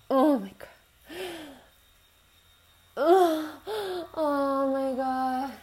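usual speaking voice

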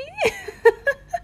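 very high, loud laugh; high burst of laughter. Acted. Laughing at the listener's broken Russian, loud laugh.

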